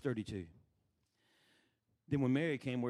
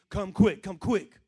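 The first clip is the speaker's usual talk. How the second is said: loud, slightly raised urgent call; urgent plea; 5 semitones higher, 11 dB louder, slower than usual. Retold or imitated. Sisters send Jesus word of a sick brother, urgent plea. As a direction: plead for help in two quick bursts.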